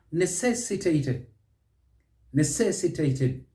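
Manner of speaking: "Necessitated" as ne-CESS-itated; second-syllable stress. Shown as a demonstration. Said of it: "Stress is on the second syllable".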